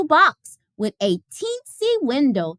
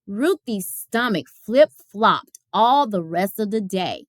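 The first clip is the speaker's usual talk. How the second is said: ordinary delivery, nothing marked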